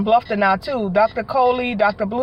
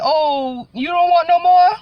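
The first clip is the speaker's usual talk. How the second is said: high, louder teasing question; lightly voiced busybody prying; mock nosy people; 6 semitones higher, 3 dB louder, slower than usual. People asking a new mother about more kids, mock teasing. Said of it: "people be like".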